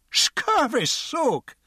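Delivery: very high, very slow indignant echo; outraged drunk. A narrator's reading. Drunk repeats the guard's insult, slow and outraged. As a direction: stretch out the insult in disbelief. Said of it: "the drunk said".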